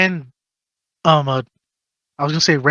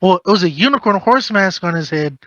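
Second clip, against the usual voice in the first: usual speaking voice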